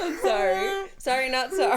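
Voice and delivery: gleeful, unrepentant laughter in the words; laughing apology. Real, not acted. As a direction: apologize while cracking up. Apologizing while still cracking up.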